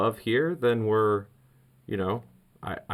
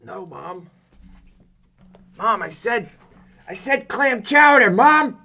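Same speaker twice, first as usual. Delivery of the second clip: loud and higher, arguing with mom; exasperated yell; 13 semitones higher, 8 dB louder than usual. Retold or imitated. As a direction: shout it across the house at mom. Yelling at mom over chowder.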